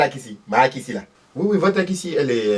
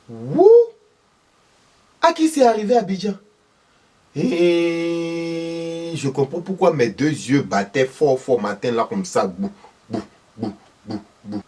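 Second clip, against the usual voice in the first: vieille maman surprise; 7 semitones higher than usual. Acted. Thérèse s'étonne de la nouvelle.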